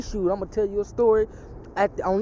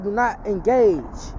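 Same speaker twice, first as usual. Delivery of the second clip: very slow, weighty command; a solemn voice of the Almighty; solemn divine command; 3 dB louder, much slower than usual. Retold or imitated. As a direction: lay each word down like scripture.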